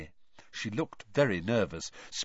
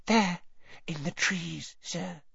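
tiny high elf voice, trailing softer; whispering Winky; 8 semitones higher, 3 dB quieter, slower than usual. A narrator's reading. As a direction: answer haltingly, small and timid. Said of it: "she whispered".

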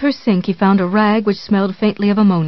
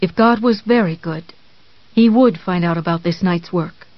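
ordinary delivery, nothing marked

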